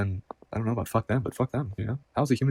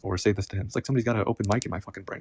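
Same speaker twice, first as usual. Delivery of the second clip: ordinary delivery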